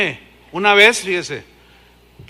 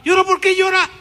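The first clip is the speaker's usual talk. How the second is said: high, loud bewildered question; puzzled question; 11 semitones higher, 7 dB louder, faster than usual. Retold or imitated. Pastor asking the crying woman why, high and loud. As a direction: ask in disbelief.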